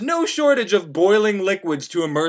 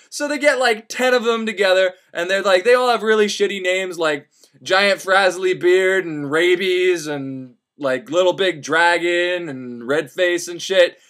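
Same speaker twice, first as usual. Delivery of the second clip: ordinary talk, nothing marked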